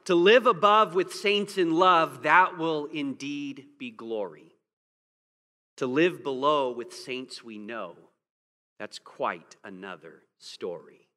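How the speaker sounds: slow recited rhyme, dropping low at the end; poem recitation. Real, not acted. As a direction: read the verse slow and wry. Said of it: "The poem puts it well".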